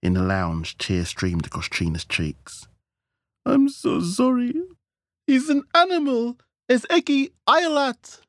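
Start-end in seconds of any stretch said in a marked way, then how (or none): 3.4-8.2 high, loud, distraught wailing voice; weeping. A narrator's reading. Wife apologises to her guests, weeping. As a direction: wail the apology through imagined tears. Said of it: "she said, weeping into her aperitif".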